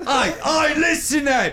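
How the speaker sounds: jokey fake British accent; laughing mock cockney. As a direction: call for attention like a stage Londoner. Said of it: "speak in a fake British accent".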